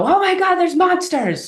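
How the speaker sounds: very high, loud fake alarm; mock panicked parent. Parent wrongly panicking over monsters, very high and loud. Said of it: "would you go in and go".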